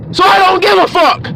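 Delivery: high, forceful dismissal; defiant outburst. Real, not acted. Preacher to complaining listeners, defiant outburst. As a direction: burst out with it, pitched high.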